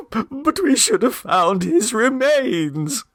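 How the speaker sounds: high, slow, lightly tearful mother; a hint of a sob in a high mother's voice; mildly sobbing mother. A narrator's reading. Mrs Mummerby fears the worst to Clovis, mild slow sob. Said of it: "sobbed Missus Mummerby".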